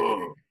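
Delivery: sudden loud gasp; sharp gasp. Real, not acted. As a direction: gasp in shock. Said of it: "you were loud in my headphones".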